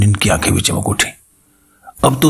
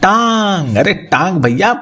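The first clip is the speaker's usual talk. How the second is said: higher, shouting "टांग" up at him; 8 semitones higher, slower than usual. A narrator's reading. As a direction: bellow the word टांग so he finally hears. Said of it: "मैंने चिल्लाकर कहा".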